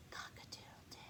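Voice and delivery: a tiny breathless whisper of a crow. Acted. Rooster losing his voice, whispered crow. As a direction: rooster trying to crow but only whispers.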